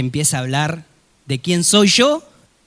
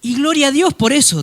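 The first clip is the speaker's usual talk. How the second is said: normal talking voice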